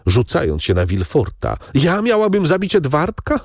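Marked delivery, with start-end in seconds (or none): 1.7-3.4 high, quick unhinged outcry; a mother gone mad, half-shrieking; madwoman's cry. Acted. Deranged mother crying out at her husband in madness. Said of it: "zawołała ta straszliwa matka".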